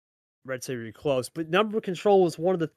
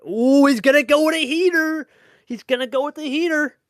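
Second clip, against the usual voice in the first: rising, louder play-by-play burst; excited call; 9 semitones higher, 7 dB louder than usual. Real, not acted. Caster calling a player's heater move, excited. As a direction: call the big play as it happens, higher and louder.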